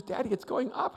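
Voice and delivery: a hint of a worried daughter; anxious child. Retold anxious daughter on the climb.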